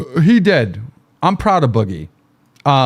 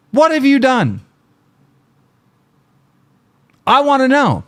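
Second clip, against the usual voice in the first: higher, pressing demand; heated demand; 8 semitones higher than usual. Real, not acted. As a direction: push him to answer, voice up. Demanding answer from critic.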